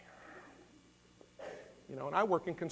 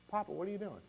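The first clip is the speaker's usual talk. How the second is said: a hint of a small boy, pitched higher; lightly voiced young son; 5 semitones higher, 5 dB quieter, faster than usual. Pastor mimics his young son questioning him, lightly child-voiced. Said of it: "my son Luke's sitting over there".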